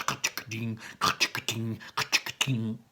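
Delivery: quiet mechanical ka-chicka-ding imitation; gear clank noise. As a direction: tick it out like turning gears.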